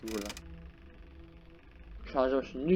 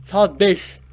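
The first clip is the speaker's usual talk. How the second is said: loud, higher-pitched cheer; excited announcement; 5 semitones higher, 10 dB louder than usual. Real, not acted. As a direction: call out the hour triumphantly. Repeats the hour as a cheer.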